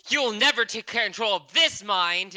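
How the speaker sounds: slow, high, loud heroic defiance; defiant yell. Acted. Player's character resisting a mental urge, defiant yell. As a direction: yell it back at the voices, drawn out. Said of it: "I yell back".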